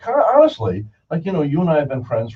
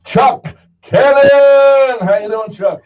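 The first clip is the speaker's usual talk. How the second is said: high, loud, slow announcer build-up; hyped introduction; 11 semitones higher, 8 dB louder, much slower than usual. Acted. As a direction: stretch out the name like a ring announcer.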